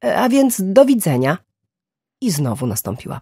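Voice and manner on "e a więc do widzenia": higher, louder, hesitant goodbye; awkward sign-off. A narrator's reading. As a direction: end a phone message uneasily, voice pitched up. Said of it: "nie za bardzo lubi mówić do tego rodzaju urządzeń".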